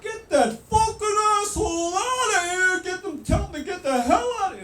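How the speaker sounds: high, loud mock scream; mock screaming curse. The furious man ordering the teller out, mock scream. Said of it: "it's something like".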